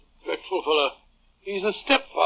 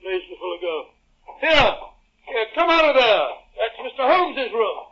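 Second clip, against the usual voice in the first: indignant, louder scolding; outraged doctor scolds the workman; angry; 2 semitones higher, 4 dB louder than usual. Acted. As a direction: drive the intruder out. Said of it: "Don't be angry with me".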